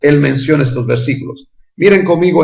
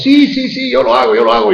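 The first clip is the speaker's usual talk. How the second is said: higher, eager mock "sí, sí, sí"; unreliable promiser; 9 semitones higher than usual. A preacher mocking someone who promises and never delivers. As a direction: parody an empty promise, lightly. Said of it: "cuando una persona dice".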